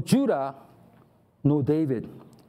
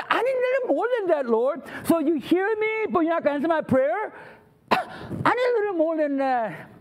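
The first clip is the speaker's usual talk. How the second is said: higher-pitched pleading whine; exasperated, hammed-up protest; whiny complaint; 5 semitones higher, faster than usual. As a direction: complain to God, wanting more. Acting complainer talking back to God.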